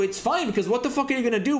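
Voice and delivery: normal talking voice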